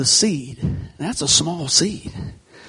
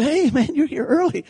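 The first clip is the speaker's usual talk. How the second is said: higher, cheerful passing hello; breezy coworker greeting; 9 semitones higher than usual. Retold or imitated. Coworker cheerfully greeting the trapped man, light and breezy. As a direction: toss it off like a friendly coworker riding by.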